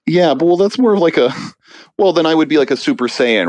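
excited, high. Real, not acted. Guest joking about anime voices, excited and high. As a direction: get worked up about it. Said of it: "joking about his voice".

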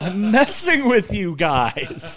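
Will Ferrell line. Retold or imitated. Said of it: "sound drop that we have from Old School".

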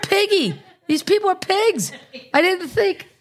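very high, hammed-up squeal; high-pitched mock outrage; mock dismay. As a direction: squeal the excuse in comic disbelief. Acting out explaining loss to bosses.

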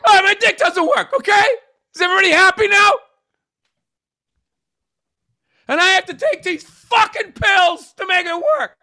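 high, loud whiny fake sob; mock crying confession. Admitting he needs the pills, high, loud fake crying. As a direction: wail it like a hammed-up breakdown.

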